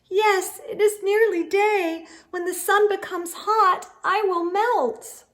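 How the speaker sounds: a hint of a small scared snowman. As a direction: snowman fearing the sun. Snowman voiced higher.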